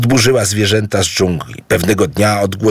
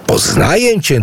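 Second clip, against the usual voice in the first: glad greeting; 11 semitones higher than usual. Acted. Idu greets the jumping mouse.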